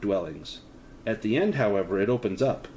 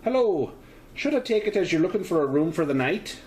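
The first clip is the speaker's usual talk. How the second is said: higher Irish landlady voice with a light lilt; 6 semitones higher than usual. Acted. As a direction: greet them as a cheerful Irish landlady. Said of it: "Her voice has a slight Irish lilt".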